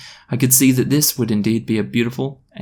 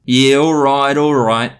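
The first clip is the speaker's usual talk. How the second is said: loud, exaggerated Australian drawl; casual Aussie drawl; 2 semitones higher, 5 dB louder, slower than usual. Zing admitting he's Australian, casual Aussie drawl. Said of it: "sighed in a casual Aussie drawl".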